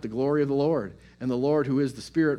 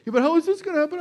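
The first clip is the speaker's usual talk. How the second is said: much higher, louder, whiny worry; anxious self; 8 dB louder, much faster than usual. Retold or imitated. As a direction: fret like a panicking kid. His own anxious reply to God.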